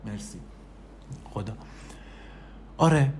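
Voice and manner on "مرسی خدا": much softer, thanking herself; quiet smug little aside; self-pleased aside. Real, not acted. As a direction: pat yourself on the back under your breath.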